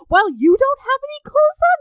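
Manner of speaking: very high, loud put-on squeal; hammed-up falsetto protest; mock shocked woman. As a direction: squeal it in outrage. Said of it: "And she's like".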